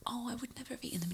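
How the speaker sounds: fast whispered retelling; whispered quote. Retold or imitated. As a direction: whisper it quickly, recounting. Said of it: "I was going".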